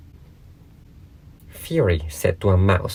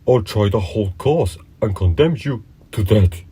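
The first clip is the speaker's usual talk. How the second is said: put-on cunning old dog; 3 semitones higher than usual. A narrator's reading. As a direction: gloat like a crafty bully. Fury condemning the mouse.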